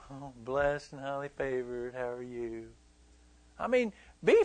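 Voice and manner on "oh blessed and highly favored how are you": soft, low, hollow churchy tone; mock the fake cheer, voice pulled back; imitating insincere church greeting; fake blessed greeting. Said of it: "it can't just be fake".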